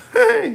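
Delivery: loud high laugh burst. Real, not acted.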